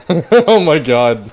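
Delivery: slow laughing disbelief; shocked laughter. Real, not acted. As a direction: laugh out an oh my god. Shocked at impossible escape.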